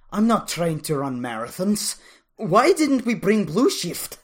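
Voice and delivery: loud high complaining huff; huffing, out of breath. A narrator's reading. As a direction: whine loudly between breaths. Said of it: "huffed out of breath".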